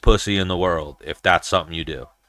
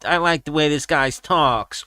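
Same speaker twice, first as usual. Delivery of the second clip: higher imitation of a Diaz accent; Diaz brother; 5 semitones higher than usual. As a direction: lightly imitate an approving Diaz-style fighter. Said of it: "they're either gonna be like".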